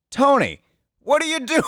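high, shocked call of Tony's name; scandalized cry. Retold or imitated. Coworker catching Tony at the film, shocked high call. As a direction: call out in disbelief. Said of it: "scandalized coworker".

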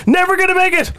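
high, wailing doomsday cry; high dramatic warning. Acted. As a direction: wail that he won't survive. Contrasting voices in mock summary.

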